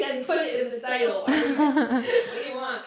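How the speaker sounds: mock exasperation. Co-host voicing the author about the title, mock exasperated. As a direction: protest as the author, amused and exasperated.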